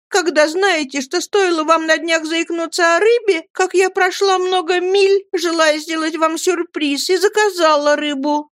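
put-on high, wailing girlish complaint; tearful reproach, acted. A narrator's reading. Dora recalls her fish surprise, high tearful reproach. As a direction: keep up a high, mock-sobbing lament. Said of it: "рыдала Дора".

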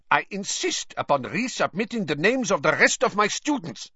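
high, hard insistence; a touch of nasty anger; ugly Karkaroff. A narrator's reading. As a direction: demand it coldly. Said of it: "dropped his unctuous tone".

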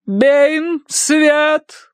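very high, drawn-out command; imperious. A narrator's reading. Madame demands light from Baine, imperious. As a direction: call out the repeated order. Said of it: "повторила мадам Эритоцкая властно".

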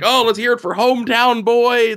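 high, brassy interviewer; announcer voice. Retold or imitated. Interviewer introducing the race winner, lightly voiced announcer. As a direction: sell the hometown boy to the crowd. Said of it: "He's like".

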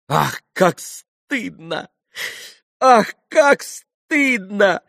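high, slow, drawn-out cry of shame; ashamed exclamation. A narrator's reading. Grushenka blushes at the cheating, exclaiming in shame. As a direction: exclaim in mounting mortification. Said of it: "воскликнула Грушенька".

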